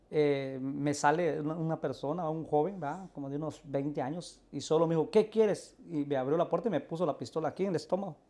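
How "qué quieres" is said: higher, louder acted demand; retold confrontational challenge. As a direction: imitate a gunman's sudden challenge at the door. Joven armado abre la puerta. (desafío brusco imitado, más agudo y fuerte)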